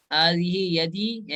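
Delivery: حاضی یدی drawn out slowly with a falling end; a teacher slowly saying حاضی یدی; slow model reading. Shown as a demonstration. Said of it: "یہ میرا ہاتھ ہے".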